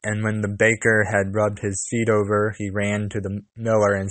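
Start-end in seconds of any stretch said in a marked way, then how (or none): none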